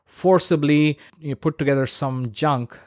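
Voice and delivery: ordinary talk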